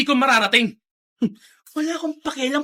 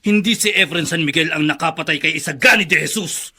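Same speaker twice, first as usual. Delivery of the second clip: menacing low voice; 9 semitones lower than usual. Acted. Oswald waiting in the congressman's room, low and cold. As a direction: speak low and threatening.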